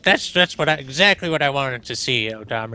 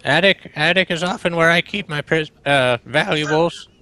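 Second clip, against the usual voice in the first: put-on grumpy old man; 6 semitones higher than usual. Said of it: "dying of my old man voice".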